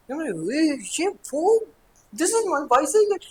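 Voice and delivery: put-on high, drawn-out thrilled child's cry; excited child. Child finding a gift bicycle, high and thrilled. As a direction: squeal with joy at the new bike.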